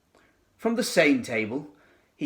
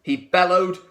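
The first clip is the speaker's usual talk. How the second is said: usual speaking voice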